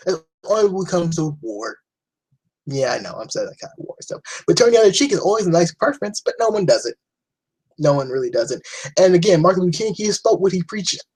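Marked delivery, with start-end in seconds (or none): none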